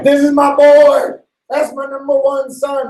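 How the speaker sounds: high, loud proud father voice; proud dad. Acted. Dad boasting about his favorite son, high and loud. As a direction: crow it like a doting dad. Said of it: "And he's like".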